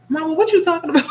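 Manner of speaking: high, baffled teasing question; playful disbelief at Mama; incredulous. Real, not acted. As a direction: ask Mama what on earth she means. Teasing her mother in chat.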